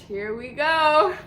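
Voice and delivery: slow, stretched mock dread; drawn-out dread. Telling friends how the high kicked in, slow dread. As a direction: drag out the words as the high hits. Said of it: "And I'm like".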